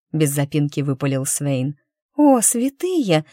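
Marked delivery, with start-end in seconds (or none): 2.2-3.2 high, loud, drawn-out exclamation; admiring drawl. Acted. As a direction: stretch it out in awe. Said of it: "восхищённо протянула Милаша". (восхищённо протянуть, громко и высоко)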